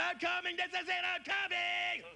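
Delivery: high, drawn-out ecstatic scream. Acted. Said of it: "She's screaming".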